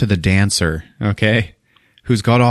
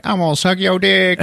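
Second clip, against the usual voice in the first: high, hammed-up dancer voice; mock seductive dancer; 10 semitones higher than usual. Masked dancer taunting the cop, high and hammed-up. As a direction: tease it in a camp falsetto. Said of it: "she just sticks her tongue out at him like".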